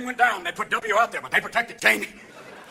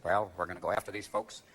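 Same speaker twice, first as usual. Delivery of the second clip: very low, soft mock-president; subdued imitation of an unprepared leader; George W. Bush; 11 dB quieter than usual. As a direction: murmur a folksy pledge deep and quiet. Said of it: "He said".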